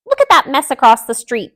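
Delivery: loud high shout. A narrator's reading. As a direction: yell across the kitchen. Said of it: "Delilah shouted".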